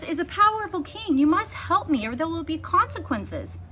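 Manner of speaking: high, loud threatening princess; haughty princess threat. A narrator's reading.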